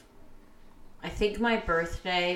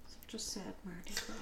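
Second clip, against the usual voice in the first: whispered; 3 semitones higher, 15 dB quieter than usual. Real, not acted. Mock sad aside about birthday.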